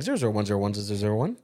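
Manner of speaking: fast, low droid binary chatter; robot voice. Pretend. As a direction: rattle it off like a robot. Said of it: "me just being like".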